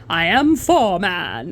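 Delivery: slow dumb-bloke voice, I am four man; a lightly voiced slow bloke declaring; caveman-ish four man. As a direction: say it slow like a thick council worker. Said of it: "That sounds like".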